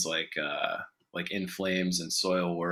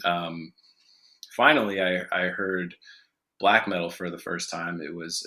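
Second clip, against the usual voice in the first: ordinary delivery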